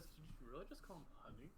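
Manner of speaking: quick, hushed disbelief; incredulous look voiced. Player reacting to "honey", quick hushed disbelief. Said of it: "I give a look that says".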